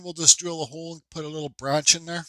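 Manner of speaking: ordinary talk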